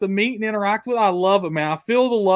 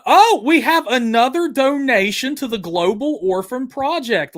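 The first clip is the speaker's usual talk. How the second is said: ordinary talk, nothing marked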